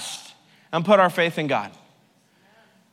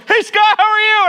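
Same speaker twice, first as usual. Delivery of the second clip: bright, much higher, loud hey Scott; friendly greeting; 8 dB louder than usual. Retold or imitated. Man in front turns round to greet Scott, loud cheery hello. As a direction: call out a cheery hello to a newcomer. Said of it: "turns around and goes".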